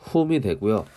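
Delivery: normal talking voice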